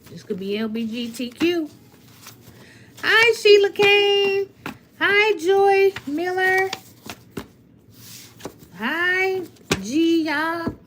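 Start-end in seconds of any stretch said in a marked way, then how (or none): 3.0-10.8 excited greetings. Real, not acted. Greeting viewers in live chat.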